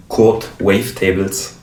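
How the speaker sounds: ordinary delivery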